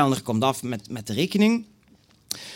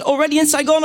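put-on Vietnamese accent; 12 semitones higher, 4 dB louder than usual. Vietnamese woman on phone.

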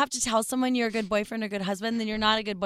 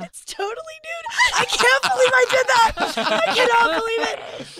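shrieking, laughing disbelief, much higher; 13 semitones higher, 6 dB louder, slower than usual. Real, not acted. Woman squealing about her own nude photo, laughing.

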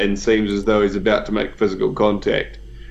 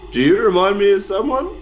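suspicious Banfor; 9 semitones higher than usual. Acted. Banfor eyes Ellerin suspiciously.

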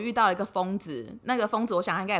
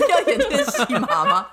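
slow, loud, laughing protest; 7 semitones higher, 8 dB louder than usual. Real, not acted. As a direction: say it while laughing. Laughing at friend's joke. (笑着慢慢抗议)

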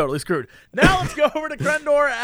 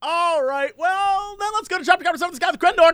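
mock news anchor; 7 semitones higher than usual. Mock newscaster handing over.

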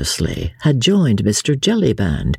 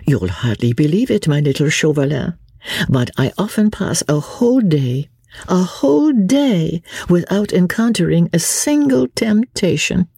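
high society lady, climbing higher; lively lady, mock-confiding; 7 semitones higher than usual. Marguerite complains of boredom to Chauvelin, high and playful.

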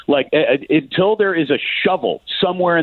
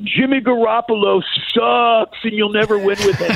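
jeering Raiders fans; 10 semitones higher than usual. Retold or imitated. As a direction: imitate a crowd heckling the quarterback. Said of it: "Raiders fans had nothing but".

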